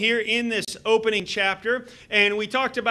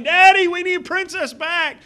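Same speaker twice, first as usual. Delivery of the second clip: put-on higher, louder pleading child voice; 6 semitones higher, 6 dB louder than usual. Preacher voicing his kids begging to get the dog back, louder and higher. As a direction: plead like a little kid for the dog.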